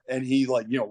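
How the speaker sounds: ordinary delivery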